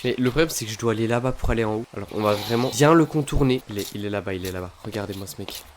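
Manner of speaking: ordinary talk, nothing marked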